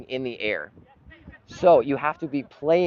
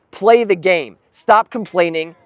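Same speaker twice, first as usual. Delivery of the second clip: loud, higher exasperated scolding; fed-up rant; 7 semitones higher, 10 dB louder, slower than usual. Real, not acted. Streamer ranting at players complaining to the referee. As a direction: scold them like an angry fan. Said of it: "There's one thing I cannot handle".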